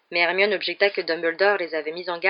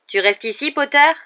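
louder call, rising at the end; jeering call; 8 semitones higher, 8 dB louder than usual. A narrator's reading. Rival pupil calls out from the queue, jeering. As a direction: taunt across a queue. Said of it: "cria Malfoy".

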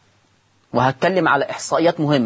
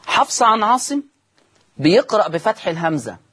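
ordinary talk

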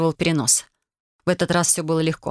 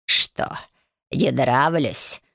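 put-on low, very slow croaking old hag; toothless old witch; 4 semitones lower, much slower than usual. A narrator's reading. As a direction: sneer it like a toothless crone. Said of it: "Беззубо усмехнулась она".

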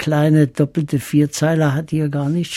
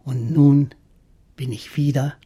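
poem recital; 3 semitones higher than usual. Acted. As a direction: recite the verses with longing. Reads his poem aloud.

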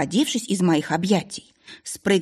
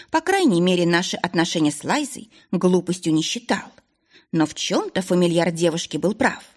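ordinary delivery

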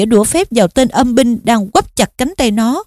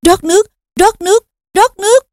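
very high, urgent repeated spell; desperate incantation; 9 semitones higher than usual. A narrator's reading. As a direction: cast it over and over, frantic. (hô thần chú tuyệt vọng)

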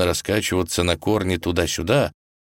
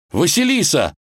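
high, loud cry of alarm; yelling; 12 semitones higher, 5 dB louder than usual. Acted. Ivan catches the falling Vasilisa by the hand, yelling. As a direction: scream her name in panic. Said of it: "завопил он".

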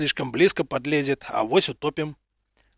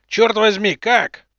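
astonished exclamation; 6 semitones higher, 7 dB louder, slower than usual. Acted. Изумлённый возглас адмирала.

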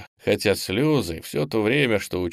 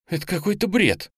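high-pitched incredulous remark; dismissive disbelief; 10 semitones higher than usual. A narrator's reading. Nastya dismissing her classmate's theory, disbelieving. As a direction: wave the idea away in a high voice.

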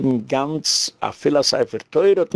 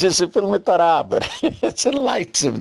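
higher voice breaking into a laugh; 6 semitones higher than usual. Real, not acted. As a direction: laugh while mocking the pictures. Amused by mocked pictures. (לאַכנדיק)

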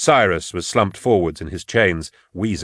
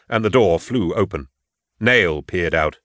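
ordinary delivery

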